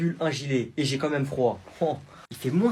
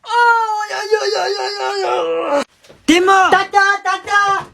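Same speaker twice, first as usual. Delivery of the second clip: high wailing ow ow; pained crying out; 13 dB louder, much slower than usual. Acted. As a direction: cry out in pain to auntie. Kid hurt, crying out. (cris de douleur aigus)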